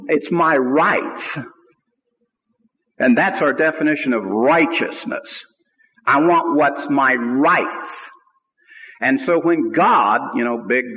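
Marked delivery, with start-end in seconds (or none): 0.1-1.4 "rights" drawn out slowly and leaned on; slow it down and press "It's my rights"; stress on rights. Shown as a demonstration. Preacher stressing to the congregation, "my rights" drawn out. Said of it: "I'm emphasizing the word right". 6.0-8.1 "I want what's my rights" said slowly with weight on want; stress on rights. Shown as a demonstration. Preacher mocking believers' selfish claim, slow and pressed. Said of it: "I'm emphasizing the word right".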